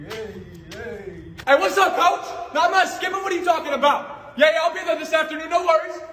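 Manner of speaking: usual speaking voice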